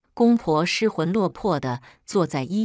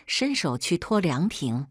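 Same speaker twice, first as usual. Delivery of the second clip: ordinary delivery, nothing marked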